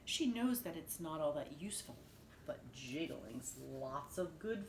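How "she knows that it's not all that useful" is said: ordinary delivery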